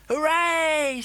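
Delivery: high, loud hooray; group cheer. A narrator's reading. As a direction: cheer like the whole crowd. Everybody cheers.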